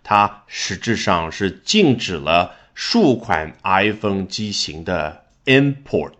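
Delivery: ordinary talk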